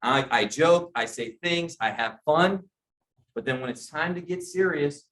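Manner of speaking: ordinary delivery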